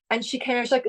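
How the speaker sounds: ordinary talk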